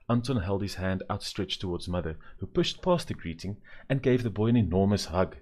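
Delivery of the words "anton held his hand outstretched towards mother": ordinary delivery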